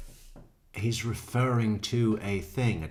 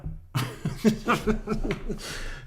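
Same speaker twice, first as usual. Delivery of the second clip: real burst of laughter; 13 semitones higher than usual. Real, not acted. Laughing at her own over-the-top suspect impression, high cackle. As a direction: crack up at your own bit.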